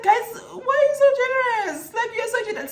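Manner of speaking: high-pitched retold amazement; overwhelmed disbelief. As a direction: squeal it in grateful disbelief. Retelling overwhelmed reaction to gifts.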